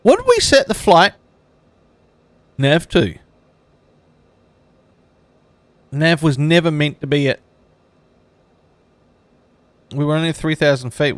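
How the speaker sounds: alarmed. Real, not acted. Flight sim goes wrong.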